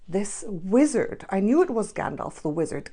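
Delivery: usual speaking voice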